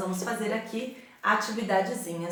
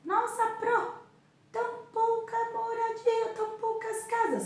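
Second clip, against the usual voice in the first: put-on much higher, soft kid's voice; student voice gasping at the few houses; surprised child; 4 dB quieter than usual. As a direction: squeak like an amazed little pupil. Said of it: "imagined student reaction".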